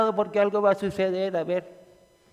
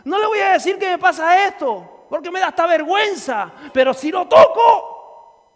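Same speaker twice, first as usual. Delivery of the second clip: high, loud determined shout; bold faith cry; 9 semitones higher, 4 dB louder than usual. Retold or imitated. The woman resolves to touch Jesus, with a high loud shout. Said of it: "esa fe de llegar y decir".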